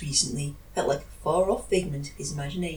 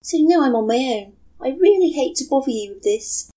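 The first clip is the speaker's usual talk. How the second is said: put-on young woman voice; 6 semitones higher, 6 dB louder than usual. Matsuri's voice held.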